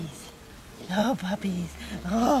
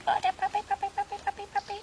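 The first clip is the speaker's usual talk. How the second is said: puppy talk; 7 semitones higher, much faster than usual. Real, not acted. Cooing over puppies.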